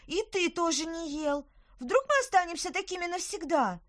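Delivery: put-on high, loud panicked child; panicked girl. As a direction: panic about staying small. Girl panics about shrinking.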